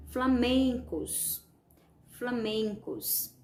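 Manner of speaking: FLAMENCOS drawn out slowly on MEN; slow "flamencos" with a long MEN; calling-out stress. Shown as a demonstration. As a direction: stretch the middle of "flamencos", as if calling someone. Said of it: "como se vocês estivessem chamando".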